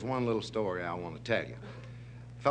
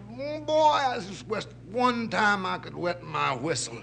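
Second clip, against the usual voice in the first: lightly voiced lion, higher and wistful; longing lion; 7 semitones higher, 3 dB louder than usual. Parched lion leaning on a tree, longing. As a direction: wish aloud as a parched lion, pitched up.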